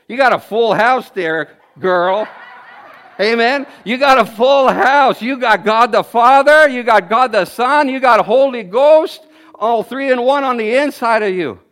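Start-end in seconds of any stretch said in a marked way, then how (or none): none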